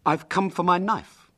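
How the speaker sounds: put-on high, matter-of-fact boy; simple. William at the Lanes' door for his knife, simply. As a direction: state it simply and innocently. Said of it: "said William simply".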